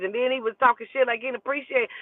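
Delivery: ordinary talk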